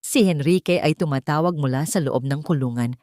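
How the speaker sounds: plain reading voice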